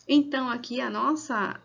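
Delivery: ordinary talk, nothing marked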